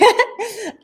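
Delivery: high giggle; embarrassed laugh. Real, not acted. Embarrassed laugh after cough.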